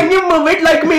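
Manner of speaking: very high cartoon king with a laugh; King Julian. Cartoon king showing off to a viewer, high giggly accent. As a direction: squeal it in a comic foreign accent. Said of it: "it's meant to be King Julian".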